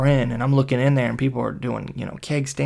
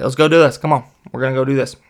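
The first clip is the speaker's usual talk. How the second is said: loud, slightly raised rallying; eager urging; 7 dB louder, faster than usual. Retold or imitated. Friends ten times worse pushing to go out, eager. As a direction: imitate friends egging each other on.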